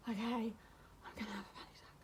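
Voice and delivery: breathy panicked whisper to oneself; re-enacted panic onset. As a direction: whisper it, imitating rising panic. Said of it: "That's exactly how I used to go".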